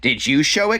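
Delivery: high-pitched acted accusation; mock confrontation. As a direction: demand it, imitating a catfight. Acted fight over the book.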